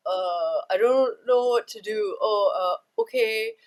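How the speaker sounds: acting out a flustered, hesitant partner; awkward helplessness. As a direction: stammer it out, at a total loss. Man flustered by partner's emotions.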